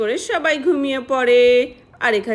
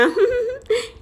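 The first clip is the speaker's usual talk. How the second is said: sneaky giggle; 10 semitones higher than usual. Acted.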